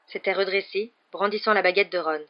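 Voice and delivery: ordinary delivery, nothing marked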